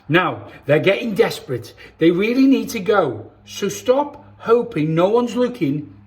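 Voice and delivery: put-on lively storybook reading for kids; reading to children. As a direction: read it like bedtime story time for little ones. Reads picture book to children.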